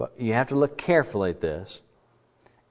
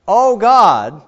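louder, higher cry to God; lament cry; 8 semitones higher, 9 dB louder than usual. Shown as a demonstration. Psalmist opening a lament, crying out loud. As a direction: call out to God in distress. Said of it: "start out with the word".

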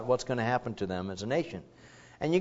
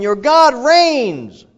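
triumphant proclamation; 11 semitones higher, 11 dB louder, much slower than usual. Acted. Proclaiming the good news.

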